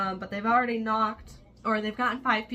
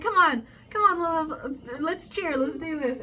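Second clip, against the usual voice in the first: much higher and louder, pumped-up cheerleader rally; rally her like an eager teammate; imitating energetic cheer; 8 semitones higher, 6 dB louder than usual. Kai hyping up Lilith to cheer for the campaign, much higher, excited mock rally. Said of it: "Kai's energetic and ready to do this".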